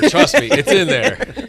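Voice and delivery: slow, loud, laughing tease. Real, not acted. Teasing Darren about empty pool.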